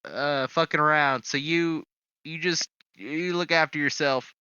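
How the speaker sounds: loud, high call through the doorway. Acted. Player calling out to his companion before slipping off, loud call. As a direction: shout it out to a roommate in another room.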